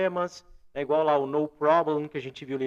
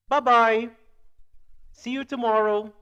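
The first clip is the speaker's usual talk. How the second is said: loud, higher English model phrases; raised, deliberate teacher demonstration; language-teacher model; 6 semitones higher, 7 dB louder, slower than usual. Shown as a demonstration. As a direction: project it clearly for learners. Model phrases for listen and read.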